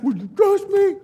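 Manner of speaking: mock-pleading worship leader; pleading young guy. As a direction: beg him to trust you, voice up high. Imitating Jaden pleading.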